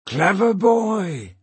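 slow, high mocking praise; taunting villain. Slank praises Peter for taking the trunk, slow taunt. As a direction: draw out the grudging praise.

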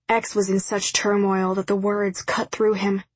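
usual speaking voice

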